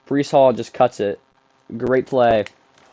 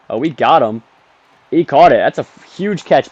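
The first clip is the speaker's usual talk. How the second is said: excited; 3 semitones higher, 7 dB louder than usual. Real, not acted. Excited at a big catch.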